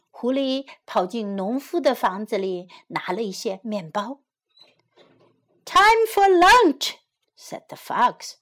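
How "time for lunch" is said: high, loud, drawn-out announcement; eager fox. A narrator's reading. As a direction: call lunchtime as a hungry fox.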